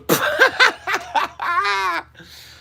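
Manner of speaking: high burst of imitated laughter; mock the waitress's big laugh; waitress cracking up. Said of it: "she goes".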